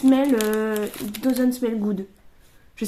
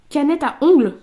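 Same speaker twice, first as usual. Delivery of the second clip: loud, higher-pitched title call; card announcement; 4 semitones higher, 10 dB louder than usual. Real, not acted. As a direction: call the card's name out high and loud. Announcing each card drawn.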